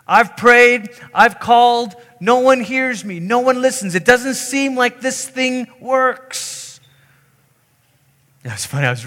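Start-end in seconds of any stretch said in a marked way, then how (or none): 0.1-6.8 raised, pleading mock despair; frustrated lament. Believer who feels no one hears prayers, acted frustration. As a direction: cry out high, slowing at the end.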